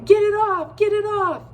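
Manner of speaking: high, loud, frantic yelling; boy tangled in a web shouting in panic; panicked shout. A narrator's reading. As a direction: scream it, desperate to get free. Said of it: "a voice was shouting".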